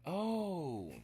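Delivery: sudden high loud oh; a real jolt of discovery; startled realization; surprised oh. Real, not acted. Surprise on finding word.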